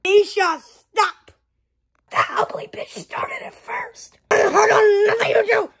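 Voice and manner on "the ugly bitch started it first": high unvoiced tattling whisper; high breathy tattle. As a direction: whisper it like telling on someone. Tattling aside during the fight.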